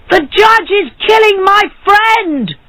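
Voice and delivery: high-pitched alarmed cry. A narrator's reading. Barber telling the street of a killing, crying out. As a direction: call it out high to rouse the street. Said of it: "he cried".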